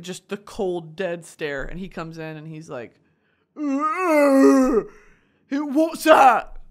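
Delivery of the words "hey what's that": loud, high mock wail; hammed-up whiny drunk outburst; drunk boyfriend. Man spots her packed luggage, hammed-up wail.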